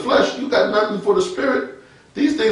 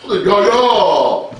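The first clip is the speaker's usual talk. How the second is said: affected holy voice; 2 semitones higher, 4 dB louder than usual. Retold or imitated. Mocking a believer's put-on way of talking, affected voice. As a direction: exaggerate the pious falling tone. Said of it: "don't gotta say it like that".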